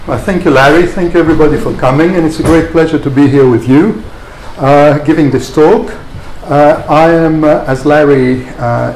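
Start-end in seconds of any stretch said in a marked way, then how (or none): none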